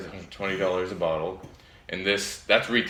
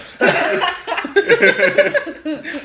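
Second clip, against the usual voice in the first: high burst of laughter; 9 dB louder than usual. Real, not acted. Reacting to The Office joke.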